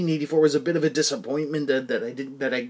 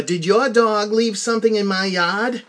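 put-on slow, loud, higher-pitched Massachusetts accent; 8 semitones higher, 7 dB louder than usual. Woman checking on a dog walker, heavy Massachusetts accent. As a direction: question a stranger in a broad Massachusetts accent. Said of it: "with heavy Massachusetts accents".

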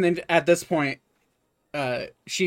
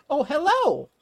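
high, bright greeting from an eager shop robot; chirpy shopkeeper; 14 semitones higher, 4 dB louder than usual. Acted. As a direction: sing out the hello like a delighted clerk. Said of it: "walks up and she's like".

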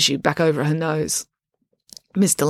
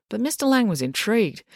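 ordinary delivery

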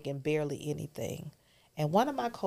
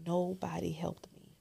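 hushed whisper; 8 dB quieter than usual. Real, not acted. Guest recalling being alone, in a hushed whisper. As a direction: whisper it like a painful confession.

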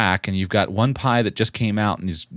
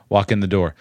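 ordinary talk, nothing marked

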